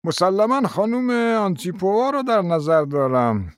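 a hint of a doddering prince; old prince. A narrator's reading. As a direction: play a rambling elderly prince. Narrator voices old prince.

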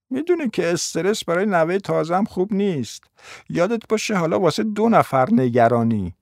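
high character voice, starting softly; grandmother's high-voiced reminder; admonishing grandmother. Grandmother admonishes her daughter.

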